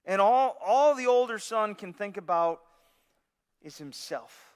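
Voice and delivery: ordinary delivery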